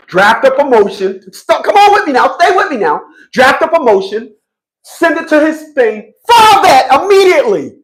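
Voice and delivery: high-pitched, hammed-up commands; rally a client in exaggerated emergency mode; mock urgent attorney. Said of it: "Her attorney is gonna say".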